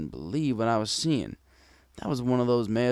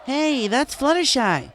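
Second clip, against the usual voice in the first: high, slow call from the crowd; 5 dB louder, slower than usual. Acted. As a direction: shout across the bar in recognition. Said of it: "a voice from behind me called out".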